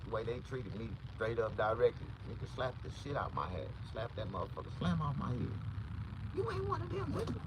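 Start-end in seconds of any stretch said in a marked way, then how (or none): 6.3-7.3 high squeaky replay. Retold or imitated.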